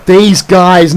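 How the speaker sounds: normal talking voice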